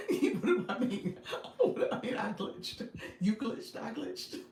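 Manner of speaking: giggling through the words; laughing. Real, not acted. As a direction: laugh at your own glitch joke. Laughing about glitching jokes.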